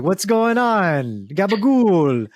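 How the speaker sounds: drawn-out, hammed-up Italian accent; mock Italian accent. Improviser showing their bad accents, mock Italian accent. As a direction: stretch it out like a cartoon Italian. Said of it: "My Italian turns into, to Dracula".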